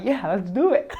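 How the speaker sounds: bursting into a high laugh mid-line. Real, not acted. Teammate joking about their old interview, laughing. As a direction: let the laugh take over the words.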